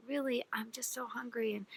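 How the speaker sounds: pleading whisper. Acted. Starving man begs miser.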